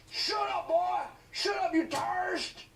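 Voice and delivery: very high, loud hammed-up yell; mock cop shout. Cops silencing the boy, loud mock shout. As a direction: bark at him like a cartoon cop. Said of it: "And he's like".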